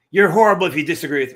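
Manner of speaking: mocking quote. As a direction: parrot the accusation with a mock sneer. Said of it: "All I'm saying is".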